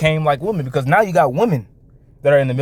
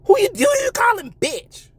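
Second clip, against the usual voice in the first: high mock shout; mock angry retort. Streamer imitating an offended woman, high mock shout. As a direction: snap back in a high put-on voice. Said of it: "And they get mad".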